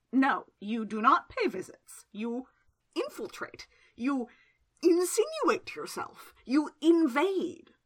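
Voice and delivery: slow, rising indignation, each verb punched; a pointed, slow list of accusations; indignant accusation. A narrator's reading. Secundus accuses Childermass.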